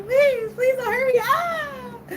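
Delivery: high, drawn-out mock wail; fake crying. Prankster begs girlfriend on the phone, fake crying. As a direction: plead in a badly faked sob. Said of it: "I was pretending to cry".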